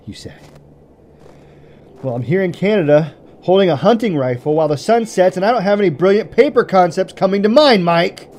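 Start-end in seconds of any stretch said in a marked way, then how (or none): 2.0-8.3 building louder and higher to an angry peak; rising frustrated rant. Acted. Ranting at friend's voicemail, building angry shout. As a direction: let the fear boil over into anger.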